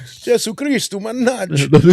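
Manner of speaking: put-on high Italian-accented exasperation; mock Italian cursing. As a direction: curse like a hammy Italian. Continues the Italian reactor joke.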